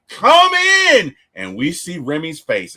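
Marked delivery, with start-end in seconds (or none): 0.1-1.1 high, loud burst of surrender; overwhelmed cry. Retold or imitated. Mother overwhelmed with fear, crying out to let vampires in, loud. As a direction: blurt it out in anguish, higher and louder. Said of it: "so overwhelmed with emotion that she's like".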